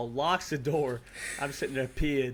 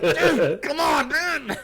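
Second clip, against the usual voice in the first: laughing protest; 8 semitones higher, 4 dB louder, much slower than usual. Retold or imitated. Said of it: "And I'm like".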